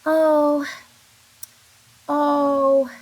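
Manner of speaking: loud, drawn-out disappointed oh; sad oh. Shown as a demonstration. Teacher acting disappointed for the class, drawn-out oh. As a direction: moan oh as if let down. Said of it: "This one looks kinda depressing".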